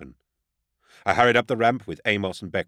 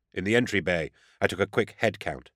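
ordinary delivery

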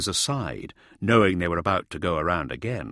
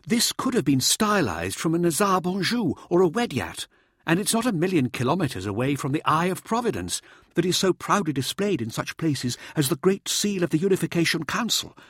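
high, insistent showboater's voice; showboating Mirza; 11 semitones higher, 4 dB louder than usual. A narrator's reading. Showboater prompts his anxious audience, high and insistent. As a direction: lecture the team in a high, theatrical voice.

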